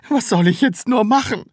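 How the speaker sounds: high, loud lament; wailing. A narrator's reading. Taschenbier at a loss with the Sams, wailing. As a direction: whine in despair. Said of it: "jammerte Herr Taschenbier".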